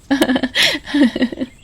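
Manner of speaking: short soft laugh of joy; happy laugh. Acted. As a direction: laugh gently with your brother. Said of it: "दोनों खुशी से हंसने लगते हैं।". (खुशी की हल्की हँसी)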